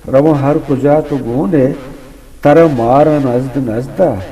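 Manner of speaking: declaimed couplets, openings lifted and stretched; ghazal recitation. Acted. Poet repeating couplets for an admiring host, rhythmic declamation. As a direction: recite with a measured lilt, savouring each line.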